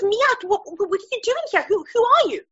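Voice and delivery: waking man's high, startled cry; retold startled alarm. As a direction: act out a man jolted awake in fright. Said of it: "And he freaks out".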